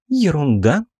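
"Ерунда?" echoed with a high indignant rise; angry disbelief. Acted. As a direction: throw the word back, incredulous. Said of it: "зло посмотрела на меня".

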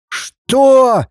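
high, outraged cry; outraged yell. A narrator's reading. Father stunned by his son's defiance, yelling. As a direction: shoot the pitch right up in outrage. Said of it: "заорал родитель".

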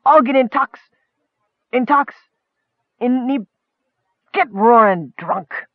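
high pitch, halting attempts at a word; stumbling proclamation. A narrator's reading. Leader announcing a drinking plan, stumbling over words. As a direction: give up and settle on plain words.

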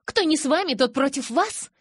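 high, sudden outburst; blurted out. A narrator's reading. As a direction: blurt it out in alarm. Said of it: "вдруг выпалила".

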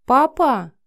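loud, high-pitched call to a parent; child calling out. A narrator's reading. Sleepy Cindy coming into the living room, calling out. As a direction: call out as a little daughter. Daughter calls to her father.

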